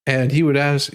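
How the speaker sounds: ordinary delivery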